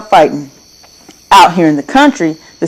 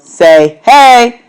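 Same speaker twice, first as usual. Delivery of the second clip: loud higher yell; 5 semitones higher, 6 dB louder than usual. A narrator's reading. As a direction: shout it out into the night. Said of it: "then yelled".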